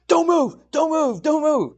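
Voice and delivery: high, urgent Army Ranger shouts; mock panicked yelling. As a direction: imitate a freaked-out Ranger yelling down. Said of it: "They're freaking out".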